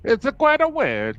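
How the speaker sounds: high mock-Italian accent; Italian horror show. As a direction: play it up as a hammy Italian. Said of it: "an Italian horror show next time".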